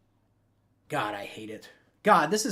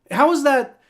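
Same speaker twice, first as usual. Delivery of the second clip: higher, louder exasperated protest; indignant outburst; 10 semitones higher, 7 dB louder than usual. Real, not acted. Fan yelling at the refs over a bogus flag, indignant. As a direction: demand an answer from the refs.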